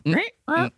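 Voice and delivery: small high grunts, evenly repeated; robot-arm stabbing grunts. Retold or imitated. Imitating the old woman stabbing, high mechanical grunts. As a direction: grunt mechanically with each jab. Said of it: "like a robot arm".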